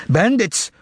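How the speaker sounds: high, outraged shout. Acted. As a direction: hurl the word at them. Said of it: "shouted Ivan".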